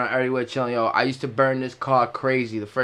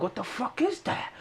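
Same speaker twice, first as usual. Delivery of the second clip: high, much softer puzzled question; startled mutter; 9 semitones higher, 10 dB quieter than usual. Retold or imitated. Alarmed driver hears noise, quiet high question. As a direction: ask it under your breath, puzzled. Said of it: "And I'm like".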